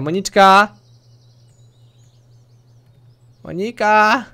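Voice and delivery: loud, high call to someone in another room. Real, not acted. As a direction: shout her name to summon her. Calling out to his partner off-mic.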